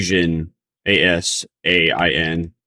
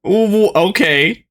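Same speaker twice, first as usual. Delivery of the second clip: surprised; 12 semitones higher, much slower than usual. Real, not acted. Reacting to accusation.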